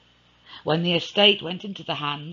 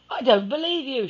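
much higher, louder denial; Alice rejecting the news, voice raised; Alice disbelieving; 12 semitones higher, 6 dB louder than usual. Acted. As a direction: refuse to believe it. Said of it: "she said".

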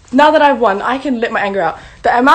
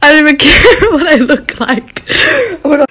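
loud, higher laughing cry of joy; breaking up with overwhelmed laughter; 5 semitones higher, 9 dB louder than usual. Real, not acted. Winner overwhelmed with joy on camera, laughing through tears.

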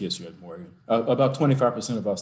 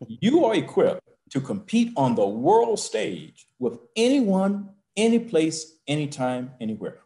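rousing speech; 8 semitones higher, 4 dB louder than usual. Real, not acted. President's rousing pitch for Morgan.

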